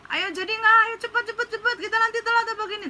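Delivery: high-pitched, rushed mimicry of friends; mock urgent nagging, rapid-fire; impatient friends hurrying. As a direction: hurry someone along in a high put-on voice. Imitates friends honking and hurrying her.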